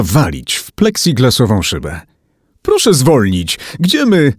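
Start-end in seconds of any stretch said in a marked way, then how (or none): 2.6-4.3 high, urgent appeal; panicked plea. A narrator's reading. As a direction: beg the driver in rising alarm. Pleading with the speeding driver.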